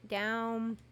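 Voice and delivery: normal talking voice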